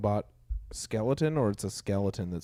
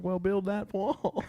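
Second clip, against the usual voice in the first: higher, slow, lightly voiced old lady; mock grandma; 10 semitones higher, 5 dB quieter, slower than usual. Friend imitating Grandma's reply, higher and slow. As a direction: drawl it like a stubborn granny. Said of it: "She's like".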